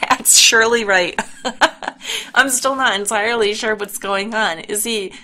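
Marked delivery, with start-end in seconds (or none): 1.2-2.3 quick airy chuckle; a brief real chuckle of disbelief; short laugh. Real, not acted. Player still amused by scene.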